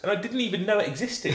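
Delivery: laughing. Real, not acted. Amused at his own discovery.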